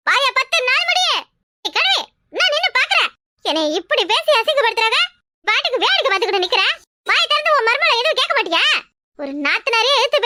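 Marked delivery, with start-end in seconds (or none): 0.1-3.1 angry put-on voice. Daughter-in-law scolds the silent old mother-in-law, high and angry. 7.1-8.6 angry put-on voice. Daughter-in-law scolds the silent old mother-in-law, high and angry.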